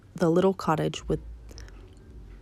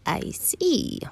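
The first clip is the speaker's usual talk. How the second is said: story wolf; 8 semitones higher than usual. Acted. Wolf takes in the girl's directions, high sliding voice. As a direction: draw it out with a scheming glide.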